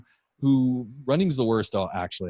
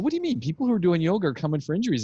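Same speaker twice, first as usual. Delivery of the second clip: high, incredulous retelling of the question; 7 semitones higher, faster than usual. Retold or imitated. Retelling a chat with the osteo, incredulous. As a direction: asks it in disbelief, pitched well up.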